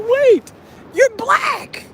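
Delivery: shocked double take; astonished. Retold or imitated.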